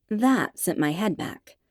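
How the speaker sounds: ordinary delivery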